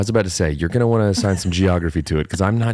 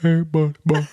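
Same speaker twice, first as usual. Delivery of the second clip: put-on friend's baby-talk voice; 9 semitones higher than usual. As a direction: imitate a man cooing to a dog, rising to squeaky. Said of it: "He starts talking like this".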